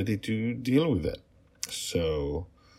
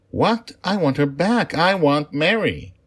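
put-on high, loud protesting wail of a small child; upset child; 11 semitones higher, 11 dB louder than usual. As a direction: cry out, refusing to accept it. Child refuses news of death.

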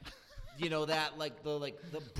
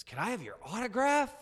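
nervous fan voice; 7 semitones higher than usual. Retold or imitated. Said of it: "And he goes".

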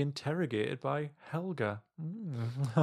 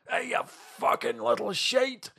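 high, drawn-out pub heckle; put-on high-pitched shout; mock shouted insult; 10 semitones higher, slower than usual. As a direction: play at yelling abuse across a pub. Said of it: "shouting random insults at people".

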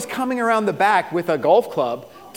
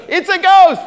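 high, loud mock panic; hammed-up frightened yell; freaking out; 12 semitones higher, 9 dB louder than usual. Preacher imitates disciples freaking out in the storm, panicked yell. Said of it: "Like, they're freaking out".

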